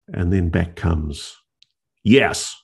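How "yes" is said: firm, raised-pitch retold yes; one decisive, emphatic word. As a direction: imitate a very firm answer. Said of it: "very firm".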